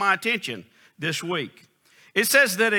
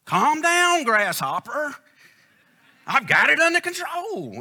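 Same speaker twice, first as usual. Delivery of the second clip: high, mock-soothing voice; imitating son-in-law; 8 semitones higher than usual. Son-in-law Alex settling the riled-up preacher, high mock-soothing imitation. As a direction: play the calm son-in-law patting him down. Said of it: "he always has a saying".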